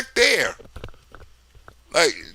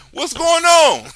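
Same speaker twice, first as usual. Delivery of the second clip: mock confusion; 7 semitones higher, slower than usual. Waking up confused.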